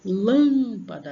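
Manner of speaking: Lâmpada called out, loud long LÂM; sílaba mais forte. Shown as a demonstration. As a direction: hit and hold the LÂM of Lâmpada. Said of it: "então ela é mais forte". (Lâmpada com LÂM forte e alongado)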